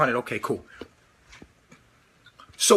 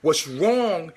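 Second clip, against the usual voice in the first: normal talking voice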